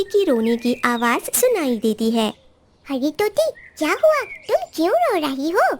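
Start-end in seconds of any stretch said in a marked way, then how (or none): 2.9-5.7 high, worried little bird voice; concerned sparrow. Acted. Sparrow asks the crying parrot what happened, high and worried. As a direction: ask gently but high-pitched.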